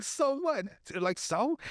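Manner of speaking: high-pitched challenge; cocky, high retort; mock defiance. Acts Jody defying the gang.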